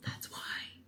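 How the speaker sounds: whispered answer; soft night whisper; quiet at night. Real, not acted. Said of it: "if you're wondering why I'm whispering".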